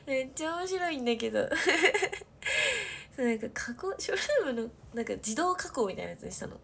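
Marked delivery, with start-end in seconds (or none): 1.5-3.1 high loud burst of laughter; real hearty laugh. Real, not acted. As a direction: crack up out loud. Keeps laughing at the filter.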